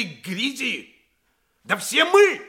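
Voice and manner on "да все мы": high, loud outcry to the assembly; rousing shout. Acted. Lawyer crying out to the fathers, a loud high shout. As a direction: fling it at the court as a challenge.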